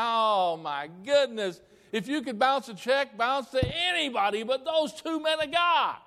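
high, loud, stretched-out horror; exasperated shouting. Retold or imitated. Pastor to secretary about bounced checks, exasperated shouting.

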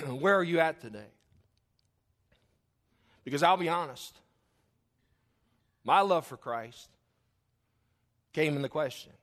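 loud, high-pitched pulpit preaching; fired-up Baptist preacher; preaching at full force. Real, not acted. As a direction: drive the point home to the church.

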